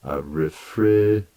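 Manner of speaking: "refri-d-j" slowed to show the added dj sound. Shown as a demonstration. Teacher slowing the "refri-d-j" sound for students. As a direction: isolate the "d-j" in "refri-d-j". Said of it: "It's like I'm adding a D".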